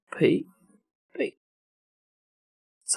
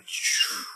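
whoosh sound; 7 dB quieter than usual. Shown as a demonstration. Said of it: "俯冲就是从高处".

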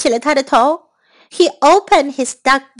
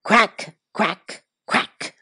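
low, softer duck-mother quacks; 6 semitones lower, 6 dB quieter, slower than usual. A narrator's reading. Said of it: "said his mother".

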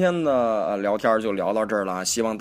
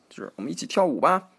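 ordinary delivery

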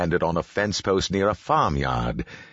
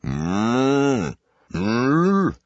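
imitated cow mooing, pitched up; cow's moo; 7 semitones higher, 4 dB louder than usual. A narrator's reading. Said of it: "said the cow".

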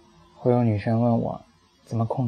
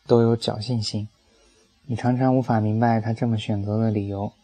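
plain reading voice

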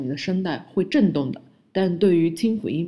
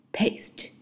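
whispered repeat; 6 dB quieter than usual. Shown as a demonstration. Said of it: "含有p音标的同音词".

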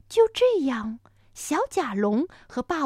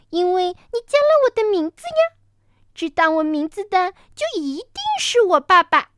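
put-on little child voice; 6 semitones higher, 6 dB louder than usual. Baby dinosaur explains innocently.

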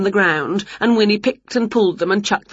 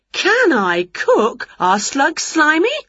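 higher, slow cocky witch voice; Winnie voiced; 9 semitones higher, slower than usual. A narrator's reading. Winnie answers the kitchen lady, in a higher, slow cocky witch voice. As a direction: boast it, slow and high. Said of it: "said Winnie".

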